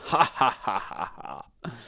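staged wicked ha-ha laugh; witch's chuckle. A narrator's reading. Witch pleased with her milk trick, gloating laugh. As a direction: laugh gloatingly in separate bursts. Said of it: "chuckled the witch".

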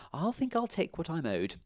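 determined mother. A narrator's reading. Jim's mother taking her money. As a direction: claim what's hers. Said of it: "said Jim's mother".

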